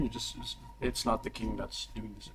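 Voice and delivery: usual speaking voice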